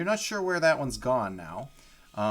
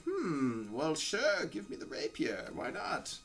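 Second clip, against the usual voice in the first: higher, soft, casually agreeable; imitated hags; 5 semitones higher, 6 dB quieter, slower than usual. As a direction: play hags idly agreeing to a request. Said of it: "And they're like".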